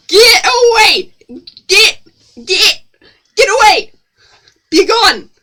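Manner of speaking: high loud shooing yell; shouting get away. Real, not acted. Yelling at chat to get away. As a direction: shoo them off at full voice.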